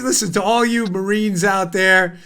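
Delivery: usual speaking voice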